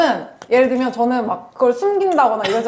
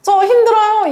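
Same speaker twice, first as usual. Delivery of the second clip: higher, louder whiny call into nothing; lonely outcry; 8 semitones higher, 5 dB louder than usual. Retold or imitated. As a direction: moan it out to nobody in particular. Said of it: "메아리처럼 그냥 나 혼자 외침".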